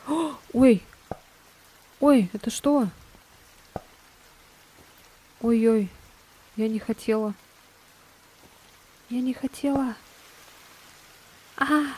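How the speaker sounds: loud startled yelps. Real, not acted. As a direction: jump at the fright, loud. Startled by jumpscare in game.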